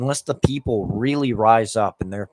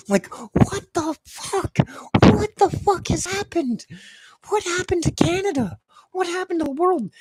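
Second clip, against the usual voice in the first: outraged wail. Real, not acted. Outraged wail over carrot prices.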